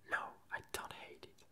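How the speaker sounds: ordinary delivery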